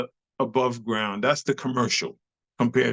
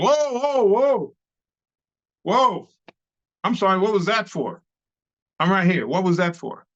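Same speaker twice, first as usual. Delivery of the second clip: mock startled whoa; 6 semitones higher than usual. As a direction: play a startled partner, hammed up. Imitating startled dance partners.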